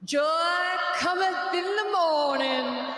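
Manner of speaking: slow, high, loud preacher voice; hammed-up revival preacher shouting slowly; mock preacher accent. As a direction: preach it from the pulpit, stretched out. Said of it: "my favorite was the preacher accent".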